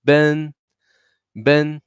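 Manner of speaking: "Ben" held high, never rising or dipping; high level tone. Shown as a demonstration. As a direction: keep each "Ben" on the same high note. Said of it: "siempre con la misma tono".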